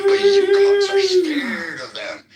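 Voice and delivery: high, loud, slowed yelling with a cry in the voice; furious shout. Real, not acted. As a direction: scream it out, voice cracking high. Furious rant at a streamer.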